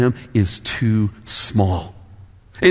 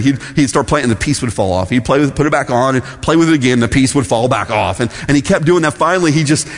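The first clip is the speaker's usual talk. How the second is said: normal talking voice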